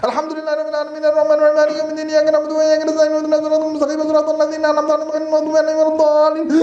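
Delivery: very fast, high mock rush through Fatiha; mocking breathless rush; one-breath Fatiha. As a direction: gabble it all in one breath. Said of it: "One breath Fatiha".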